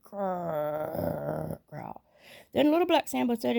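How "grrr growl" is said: low tiger growl; mock tiger growl. A narrator's reading. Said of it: "the tigers only answered".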